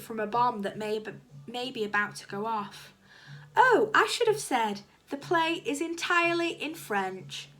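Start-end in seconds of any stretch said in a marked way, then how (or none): 3.6-7.5 high, loud afterthought; calling after. A narrator's reading. Miss Windsor to Dennis as he walks away, calling after him. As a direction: call it after someone walking off. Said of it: "she called after him".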